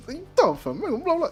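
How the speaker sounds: mock Tony rant. Tony yelling at the bomber, high and loud. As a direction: yell it as a hammed-up Tony.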